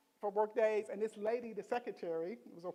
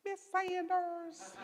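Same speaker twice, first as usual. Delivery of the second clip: put-on high, slow country child's voice, laughing; country accent; 10 semitones higher, much slower than usual. Kimberly calls her teacher in a slow, high country accent. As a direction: say it like a little country girl. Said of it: "she had a real country little accent".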